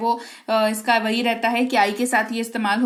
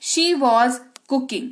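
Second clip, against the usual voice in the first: slow, even, word by word; slow model sentence; much slower than usual. Shown as a demonstration. Teacher modelling the English sentence slowly. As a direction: read it slowly so students follow. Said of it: "वह खाना बना रही थी".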